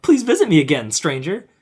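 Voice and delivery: hammed-up imitation of an inviting mother; movie mother pleading. Podcaster mimicking mother to stranger, mock pleading. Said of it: "He's like".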